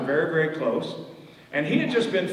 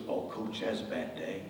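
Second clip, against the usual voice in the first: mournful hush; 6 semitones lower, 10 dB quieter than usual. Retold or imitated. Dad recalls his bad day.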